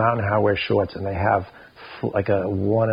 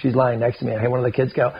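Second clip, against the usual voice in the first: usual speaking voice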